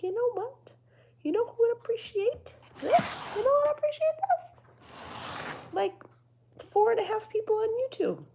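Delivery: very high, loud, bright mock enthusiasm; high mock-excited voice. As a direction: squeal it like an eager fangirl. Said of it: "And then I was like".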